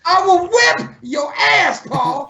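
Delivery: threatening a friend over an impression, mock fury; mock angry threat. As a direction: bluster at a friend over a joke.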